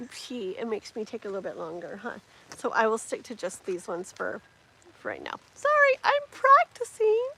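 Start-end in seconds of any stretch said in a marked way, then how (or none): 5.5-7.3 high-pitched, loud mock-tearful whine; whining. Real, not acted.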